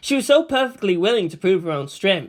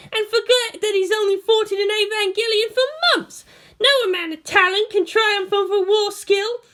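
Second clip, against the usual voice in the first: put-on much higher, loud, contemptuous girl; Asuka's scornful voice; 4 dB louder than usual. A narrator's reading. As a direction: lecture silly fans with cutting scorn. Asuka rants at the girls.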